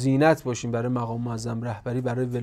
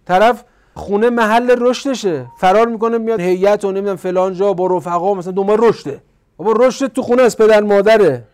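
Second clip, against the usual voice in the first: loud, high exhorting voice; raised sermon voice; forceful preaching; 6 semitones higher, 9 dB louder than usual. Real, not acted. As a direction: press the lesson home urgently.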